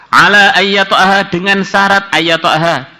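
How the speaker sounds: hadith recitation. Acted. Teacher recites the condition in the hadith, slow. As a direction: intone the text slowly. Reads the Arabic hadith text.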